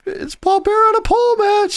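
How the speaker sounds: very high comic squeal; hammed-up high announcement of a joke match; put-on wailing character. Co-host joins the mock voice.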